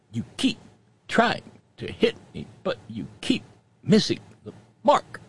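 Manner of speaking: a hint of a Star Trek villain; Star Trek quote. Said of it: "our obligatory Star Trek reference".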